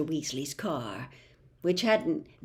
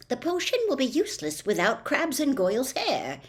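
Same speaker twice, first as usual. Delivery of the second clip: a firm, raised schoolgirl voice; stern; 6 semitones higher than usual. A narrator's reading. Hermione insisting to reluctant Harry and Ron, stern. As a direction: put your foot down. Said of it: "she had sternly".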